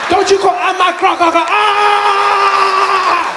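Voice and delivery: shrieking at him in rage; furious shout. Pretend. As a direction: yell it like a furious accusation. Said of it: "That's probably what Reva said to Oscar that night".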